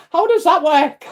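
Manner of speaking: high, loud laughing question. Real, not acted. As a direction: laugh at how odd it was. Laughs at her own crying.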